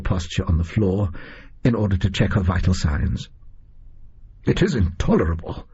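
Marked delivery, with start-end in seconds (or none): none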